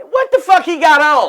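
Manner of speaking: loud high mocking yell; mock heckler; heckler shout. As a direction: shout it the moment he walks out. Heckler mocks outfit.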